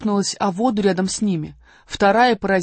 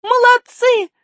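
high loud joyful cheer; cheering shout; 8 dB louder, slower than usual. Acted. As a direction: cheer them on. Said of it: "закричала Алиса". (радостный крик)

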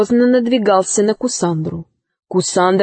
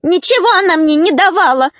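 higher-voiced gnome denying it; indignant gnome; 7 semitones higher, faster than usual. Gnome denies the villain's accusation, high protest. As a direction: push back in a high little gnome voice.

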